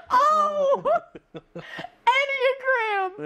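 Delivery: high, slow, crying with laughter; laughing correction. Retold or imitated. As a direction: cry out through helpless laughter. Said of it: "I went".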